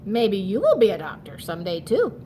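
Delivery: ordinary delivery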